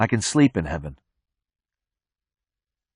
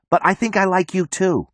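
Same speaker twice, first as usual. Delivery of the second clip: shout; 9 semitones higher than usual. A narrator's reading. Marla confesses her liking across the roof, high restrained shout. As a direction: lift the voice high as if calling across. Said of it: "Marla shouts".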